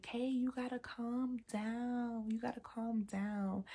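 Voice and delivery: slow, soft imitation of grandma soothing. Grandma calms her angry granddaughter, imitated slow soft soothing. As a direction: draw it out softly, like grandma settling someone. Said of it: "So my grandma was like".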